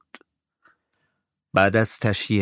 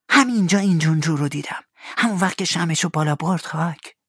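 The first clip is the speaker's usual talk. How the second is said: put-on fast, higher boy; hurried Tom; 8 semitones higher than usual. Tom recalls Injun Joe to Huck, fast. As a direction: rush it nervously.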